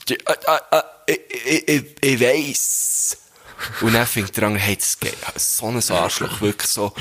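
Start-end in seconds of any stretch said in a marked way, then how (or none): 0.1-3.2 hesitant stammer, slowing to a drawn-out weiss; stuttering man. Acted. Second man stutters back to the first, drawn out. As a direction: stumble over ich, ich, ich. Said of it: "Und er sagt der andere so".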